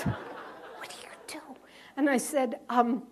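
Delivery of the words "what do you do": slow soft whisper with a hint of a laugh; a clerk whispering his question again. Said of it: "And he's whispering".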